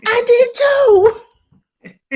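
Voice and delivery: put-on very high, drawn-out little-kid delight; imitated excited child. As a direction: squeal it slowly like a proud child.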